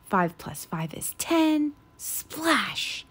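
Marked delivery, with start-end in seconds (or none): none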